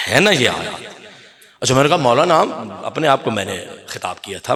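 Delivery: usual speaking voice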